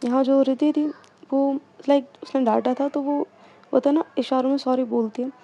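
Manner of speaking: ordinary delivery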